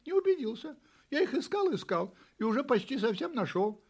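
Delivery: high-pitched, earnest little kitten. A narrator's reading. Kitten answers neighbour cat. (высокий голосок котёнка)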